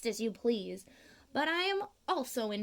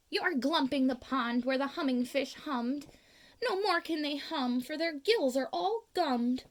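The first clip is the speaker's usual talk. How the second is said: ordinary delivery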